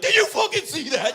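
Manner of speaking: very high, loud disbelieving shout; ecstatic yelling. Acted. Driver amazed at his own feat, ecstatic yelling. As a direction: yell it like you just witnessed a miracle. Celebrating an amazing feat alone.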